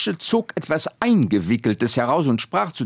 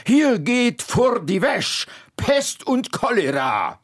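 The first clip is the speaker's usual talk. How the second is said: slow, much higher cursing; fierce old woman; 9 semitones higher than usual. Acted. Old Guschke glaring at the woodcutter, slow high curse. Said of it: "mit einem tatarischen Blick auf den Holzfäller".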